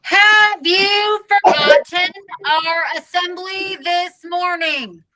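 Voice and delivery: slow, high, loud through a bullhorn; bullhorn shout. Acted. Principal through a bullhorn at the silent cafeteria, slow loud shout. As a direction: blast it through a megaphone at the room. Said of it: "The principal's voice echoed off the walls".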